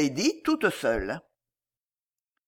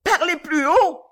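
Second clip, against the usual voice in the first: high, loud demand; shrill voice; 10 semitones higher, 6 dB louder than usual. A narrator's reading. Deaf old lady ordering Joe to speak up, shrill. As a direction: call out shrilly to be heard. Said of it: "d'une voix aiguë".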